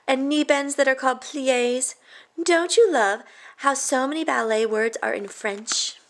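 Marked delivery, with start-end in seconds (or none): none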